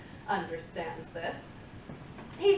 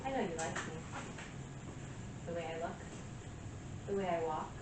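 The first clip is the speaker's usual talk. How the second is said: self-assured seduction; 7 dB quieter than usual. Acted. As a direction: play it softly seductive, savouring each pause. Monologue, flirting with listener she claims desires her.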